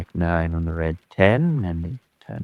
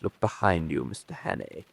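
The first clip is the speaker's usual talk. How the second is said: quiet menace; 9 dB quieter than usual. Acted. Reveals trap, soft menace.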